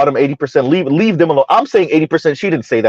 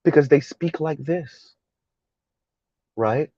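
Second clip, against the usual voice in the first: mock calm demeanor; 6 dB quieter than usual. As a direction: feign composure, every syllable placed gently. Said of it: "that sounds like this when they speak".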